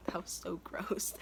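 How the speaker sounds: a whispered aside, barely voiced; grossed-out whisper. Real, not acted. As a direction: whisper it to yourself in disgust. Aside whispered after disgust.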